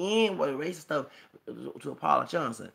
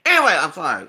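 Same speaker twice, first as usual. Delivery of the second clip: loud emotional apology; 3 semitones higher, 14 dB louder, faster than usual. Real, not acted. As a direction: shout it to reset the rant. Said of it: "I get all emotional".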